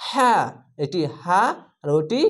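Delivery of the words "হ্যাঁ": হ্যাঁ said with air pushed out; breathy ha. Shown as a demonstration. Teacher showing students the breathy ha against the plain one. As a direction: let breath escape on হ্যাঁ. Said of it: "বাতাস বের হবে".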